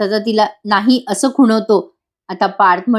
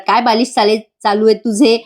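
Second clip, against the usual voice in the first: usual speaking voice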